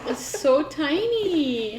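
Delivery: high, drawn-out squeal of delight; real high-pitched gushing; delighted cooing. Real, not acted. Cooing over a miniature box.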